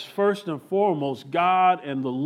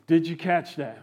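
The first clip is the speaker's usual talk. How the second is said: normal talking voice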